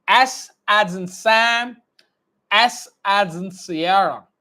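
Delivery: slow, clearly mouthed letter drill; teacher drilling phonetic alphabet; slow dictation. Shown as a demonstration. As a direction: model every sound slowly. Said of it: "I say Sam and Sierra".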